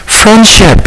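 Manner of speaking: "Friendship" said high and sharp; fed up, spelling it out for her; mildly exasperated correction. A narrator's reading. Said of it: "utterly exasperated".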